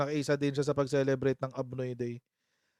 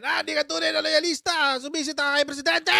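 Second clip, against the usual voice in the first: high-pitched hammed-up angry accusation; mock angry; 10 semitones higher, 4 dB louder than usual. Imitating angry loyalists scolding the host, mock fury. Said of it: "Yung mga magagalit na naman sa akin".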